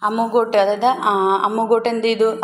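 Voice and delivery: usual speaking voice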